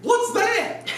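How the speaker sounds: high squeaky puzzled call; mock surprised soldier. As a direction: ham up a startled soldier spotting something. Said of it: "and they go".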